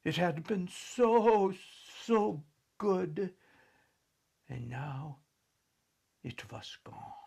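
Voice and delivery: mournful fading. A narrator's reading. Mourning lost glory.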